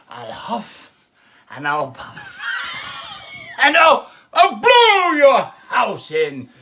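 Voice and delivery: loud, slow growling wolf threat; hammed-up wolf bellowing at the straw house; big bad wolf. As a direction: roar it out like the hungry wolf.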